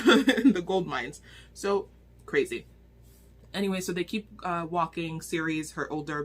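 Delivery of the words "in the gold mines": louder, laughing through the words; laughing correction. Real, not acted. Reviewer fixing her own slip to viewers, laughing.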